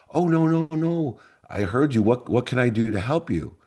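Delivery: reassuring mom. Retold or imitated. Mom reassuring her bankrupt son, caring. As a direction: brush off his worry and offer help.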